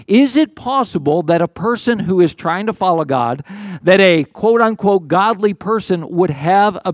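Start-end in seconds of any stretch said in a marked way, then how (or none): none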